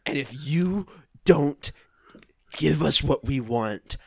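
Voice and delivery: normal talking voice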